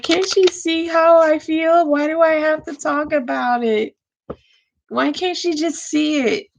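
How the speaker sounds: high-pitched, exasperated plaint; acting out a frustrated complaint. Reader voices a man wishing she could see his feelings, exasperated.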